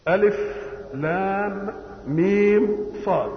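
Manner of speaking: ألف لام ميم صاد drawn out slowly, each letter named apart; slow deliberate letter-by-letter recitation of ألف لام ميم صاد; letters spelled slowly. Shown as a demonstration. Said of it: "كل ما يجيب حرف مقطع".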